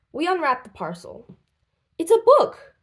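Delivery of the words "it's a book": high, loud burst of surprise; surprised. Acted. Andy unwraps his present, loud surprised cry. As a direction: blurt it out amazed. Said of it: "I say".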